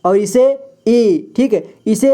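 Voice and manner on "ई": ई held long; drawn-out, sustained ई; long vowel. Shown as a demonstration. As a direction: stretch the ई out as a long ee. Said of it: "ज़्यादा समय खींचते हैं इसे पढ़ने के लिए".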